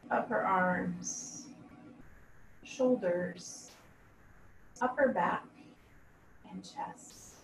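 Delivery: slow, measured yoga nidra voice with long pauses; guided meditation. Acted. Yoga teacher leading students through a body scan, calm guided meditation. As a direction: guide them calmly, letting each body part settle.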